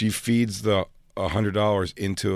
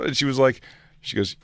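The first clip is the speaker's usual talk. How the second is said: ordinary talk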